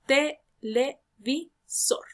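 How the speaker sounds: "Televisor" very slowly, four separate beats ending on SOR; syllable by syllable. Shown as a demonstration. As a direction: leave a clear gap after each syllable of "Televisor". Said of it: "lleva en la última sílaba". ("Televisor" muy lento, con pausa entre sílabas y acento en SOR)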